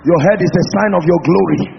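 low, soft, intimate prayer; hushed prayer. Real, not acted. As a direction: pray softly and low.

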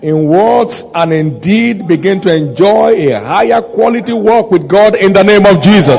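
emphatic pulpit proclamation; fervent preaching prayer. Real, not acted. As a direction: declare it over the congregation with force. Closing blessing at full voice.

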